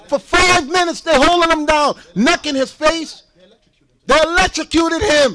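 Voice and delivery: loud, outraged pulpit delivery; heated preaching. Real, not acted. Preacher recounting a jail tasing, heated and forceful. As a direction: hammer each phrase at the congregation.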